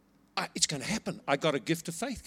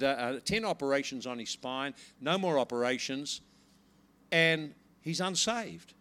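ordinary delivery

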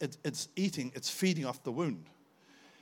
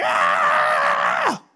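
wordless raw yell, full volume; madman shout; 8 semitones lower, 14 dB louder than usual. Retold or imitated. Preacher reliving the curse, bursting out in a wordless yell. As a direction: let out a wild cry like a madman. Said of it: "shout like a madman".

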